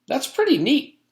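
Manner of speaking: put-on high, admiring child voice; a girl impressed by the plate; high childish praise.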